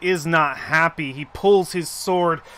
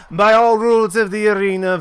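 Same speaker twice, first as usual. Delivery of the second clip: raised, loud official ruling; announcer proclamation; 5 semitones higher, 7 dB louder than usual. Acted. Arena mistress calms the crowd, loud and official. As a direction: project it over the crowd.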